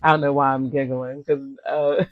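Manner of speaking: ordinary talk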